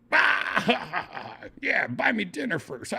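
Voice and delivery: high-pitched imitated guffaw; laughing comeback. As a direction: burst out laughing through the retort.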